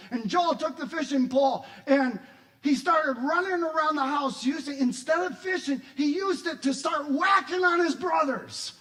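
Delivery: loud, high-pitched excited retelling; animated storytelling. Retold or imitated. Preacher telling how Joel went wild, excited. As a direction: tell the mayhem at full volume.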